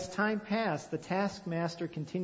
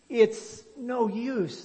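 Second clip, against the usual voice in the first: hopeless cry; 6 semitones higher, 3 dB louder, much slower than usual. A narrator's reading. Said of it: "the people cried".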